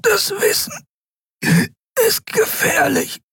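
very high choked squeeze, broken up; struggling for air. A narrator's reading. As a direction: force each phrase out between gasps. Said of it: "Kai rang nach Luft". (gewürgt, nach Luft ringend)

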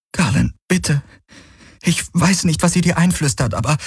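high-pitched, anxious plea; urgent pleading. Acted. She reaches for his arm to reason with him, urgent pleading. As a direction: implore him, voice lifted high. Kirai fasst bittend nach seinem Arm.

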